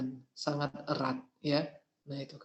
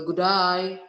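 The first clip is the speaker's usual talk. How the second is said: "good day" in an Australian accent, run together like "g'day", louder; 7 dB louder than usual. Shown as a demonstration. Teacher showing students the Australian greeting in an Australian accent. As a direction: say "good day" the Australian way, as one quick "g'dai". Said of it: "di Australia misalnya bilang kan".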